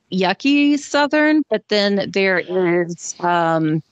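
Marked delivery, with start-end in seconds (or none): 0.1-1.4 drawn-out, higher hillbilly Southern twang; mock yucky Southern drawl; hillbilly Southern accent. Shown as a demonstration. Mimicking a hillbilly Southern type.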